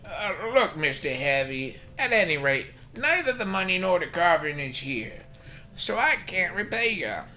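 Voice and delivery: put-on high, loud, put-upon old doctor grumbling; high-pitched cranky old man complaining about his debts; crusty doctor voice. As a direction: play him as a stingy old codger brushing off a visitor.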